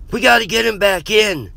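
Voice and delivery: high, loud urgent yell; panicked scream. A narrator's reading. Dante urges them to lift injured Jeff, screaming. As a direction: scream it to get help fast. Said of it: "Dante screamed".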